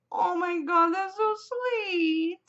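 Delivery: high, drawn-out weepy squeal; mock tearful gushing. Vlogger acts out reaction to a sister's love, mock tearful gushing. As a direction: gush as if about to cry, hammed up. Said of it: "who was crying along".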